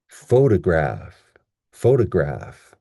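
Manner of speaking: "photograph" measured, with strong PHO; stress on first syllable. Shown as a demonstration. Teacher drilling the word, slow first-syllable stress on "photograph". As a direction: punch PHO, lighter graph in "photograph". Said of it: "we have the stress at the beginning".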